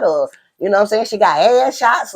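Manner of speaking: ordinary talk, nothing marked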